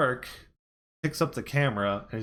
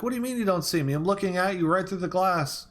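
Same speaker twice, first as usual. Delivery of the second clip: high, urgent mock plea; retold desperation; 8 semitones higher, faster than usual. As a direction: imitate someone trapped and insisting on a way out. Trapped manager pleads through the wall.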